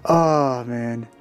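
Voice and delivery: usual speaking voice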